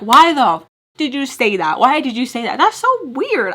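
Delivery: loud, high inner outburst; exasperated self-scolding. Retold or imitated. Mom scolding herself inwardly after blurting something, exasperated. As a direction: berate yourself loudly in mock disbelief.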